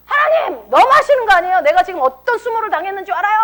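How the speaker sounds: high, loud outraged protest; acted anger at God. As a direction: lash out at God, pitch leaping up. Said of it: "또 하나님께 화를 내는 거예요". (하나님께 화내듯 높고 크게)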